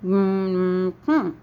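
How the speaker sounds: three hummed syllables; closed-mouth hum. Shown as a demonstration. Teacher says unicorn with the mouth closed, three-beat hum. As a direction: hum a long three-beat word through shut lips. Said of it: "with the mouth closed".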